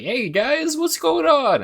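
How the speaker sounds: high squeaky mascot voice; tiny cartoon critter. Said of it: "using their mascot voices".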